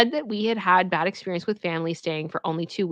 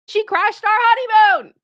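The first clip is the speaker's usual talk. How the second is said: high, loud outraged yell; outraged shout; 12 semitones higher, 8 dB louder than usual. Real, not acted. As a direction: shout it in disbelief.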